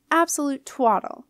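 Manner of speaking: loud dismissive harrumph; harumphing. A narrator's reading. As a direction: scoff at it like an outraged old queen. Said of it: "harumphed Jenna's grandmother".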